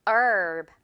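herb. with no H, long "얼"; silent h. Shown as a demonstration. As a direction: drop the H and stretch the vowel. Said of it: "얼 발음 길게 끌어주세요". (H 묵음, 얼 길게 끌기)

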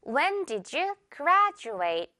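"graduate" said GRAD-joo-ate, slowly; j sound in graduate. Shown as a demonstration. Teacher modelling the question for students, j sound. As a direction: say "When did you graduate" with a j for the d. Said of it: "듣고 바로 갑니다".

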